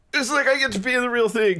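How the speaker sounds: high-pitched mock-giddy quote of himself; retold excitement. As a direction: play up the thrill. Retelling own thrill at the shoot.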